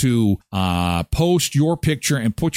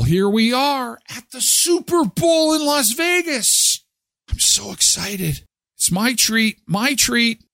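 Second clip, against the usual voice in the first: much higher, hammed-up announcer thrill; mock excited; 9 semitones higher than usual. Host pretending to be thrilled at the Super Bowl, mock excited. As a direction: play the giddy fan who just walked into the stadium.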